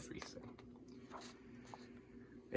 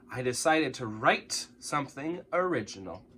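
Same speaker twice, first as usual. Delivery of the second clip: plain reading voice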